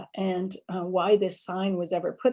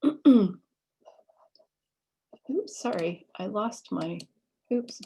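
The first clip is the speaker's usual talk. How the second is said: ordinary delivery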